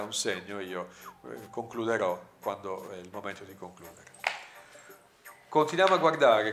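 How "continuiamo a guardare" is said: shouted out, louder and higher; grido. A narrator's reading. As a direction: call it out across the distance. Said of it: "gridò a Midnight Lindo". (gridato forte)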